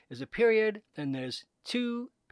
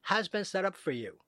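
usual speaking voice

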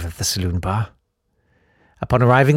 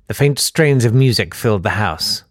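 plain reading voice

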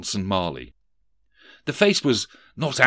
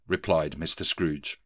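plain reading voice

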